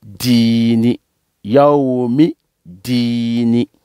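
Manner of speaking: "Dini" without the opening a, i held long; "Yaoumi Dini" drawn out, straight from mi into dii; joined recitation. Shown as a demonstration. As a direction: link "Yaoumi" to "Dini" with no article heard. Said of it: "quand on coupe pas, on veut coller".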